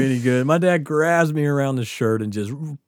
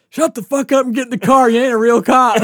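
much higher, louder angry bark; angry order; 11 semitones higher, 8 dB louder than usual. Retold or imitated. Barney orders the auxiliary guy into the car, angry. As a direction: put the fake cop in his place.

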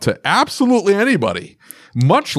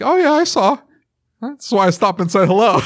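much higher, casual, laughing; breezy unbothered reply; 9 semitones higher than usual. Retold or imitated. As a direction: shrug it off cheerfully with a laugh. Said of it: "he's like".

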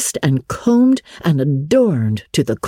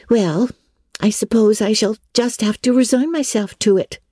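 put-on higher, admiring young friend, a touch dreamy; girl persuading her friend, lightly voiced higher; lightly voiced girl Diana; 8 semitones higher than usual. A narrator's reading. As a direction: coax her friend toward the organdy.

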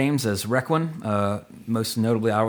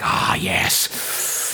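breathy, whispered villain impression; Jack Palance. Imitating the actor in Batman, breathy and sinister. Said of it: "he's like".

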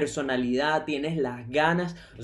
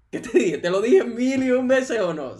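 louder, higher, gloating; triumphant told-you-so; 6 semitones higher, 5 dB louder than usual. Retold or imitated. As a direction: rub it in playfully, I told you so. Te voy a decir, qué te dije. (con tono triunfal de "te lo dije")

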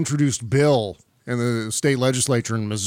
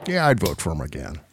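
very low, resigned mock voice; grudging politician impression; 10 semitones lower than usual. Host as Barr after the missiles hit, deep grudging impression. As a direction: sink deep and concede wearily.

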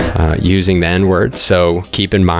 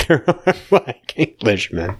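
laughing through the words, higher; amused, giggly repeat; 4 semitones higher than usual. Real, not acted. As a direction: break into a laugh while conceding. Amused echo of the remark.

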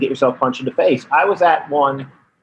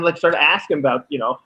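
usual speaking voice

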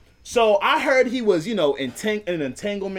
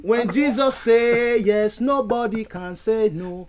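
slow preacher cadence; much slower than usual. Pretend. Preaching echo of deacon.

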